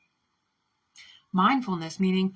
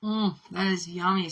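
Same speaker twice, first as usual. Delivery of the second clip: usual speaking voice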